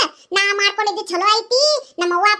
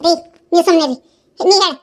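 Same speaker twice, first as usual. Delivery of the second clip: louder, cutting off the husband; curt louder command; sharp angry order; 4 semitones lower, 4 dB louder than usual. Acted. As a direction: shut him up and turn on the daughter. Wife silences husband, demands answer.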